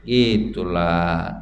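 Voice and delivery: normal talking voice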